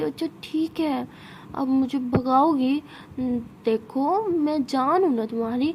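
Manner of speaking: slow, drawn-out cute pleading; wheedling. Acted. Siya reminding Tara she is her darling, wheedling. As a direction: coax slowly, playing the hurt darling.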